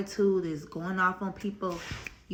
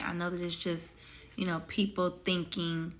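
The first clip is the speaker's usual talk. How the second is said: ordinary delivery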